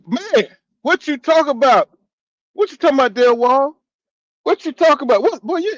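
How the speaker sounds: an excited shout-out to a returning viewer; excited greeting. Real, not acted. Greeting an old friend in chat.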